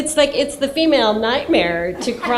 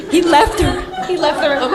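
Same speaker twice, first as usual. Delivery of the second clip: laughing; 7 semitones higher, 4 dB louder than usual. Real, not acted. Laughing while telling story.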